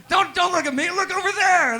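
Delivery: high, loud, exasperated outburst; mock scolding shout. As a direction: yell at the crowd in comic exasperation. Scolds audience for looking at him.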